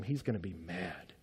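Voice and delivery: low and hushed, sinking away; soft, grave threat; ominous warning. Retold or imitated. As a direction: drop deep and quiet with dread. Warning of God's coming anger.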